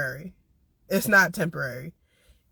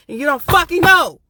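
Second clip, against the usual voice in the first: real anger, loud and higher; angry outburst; 8 semitones higher, 8 dB louder than usual. Real, not acted. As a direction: shout it out, fed up. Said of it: "I'm literally angry".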